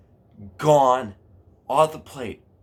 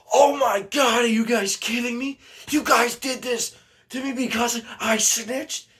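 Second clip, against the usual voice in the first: high, loud imitation of indignation; mock outrage; 13 semitones higher, 4 dB louder than usual. Pranked friend learns it was payback, mock outrage. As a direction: wail the accusation in a much higher voice.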